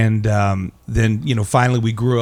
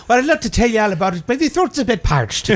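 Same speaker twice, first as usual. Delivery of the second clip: Irish lilt, higher and fast; lilting Irish storyteller; 11 semitones higher than usual. Acted. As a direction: spin it like an old Irish yarn-teller. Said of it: "the way every Irish storyteller begins".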